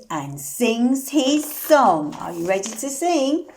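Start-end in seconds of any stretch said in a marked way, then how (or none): none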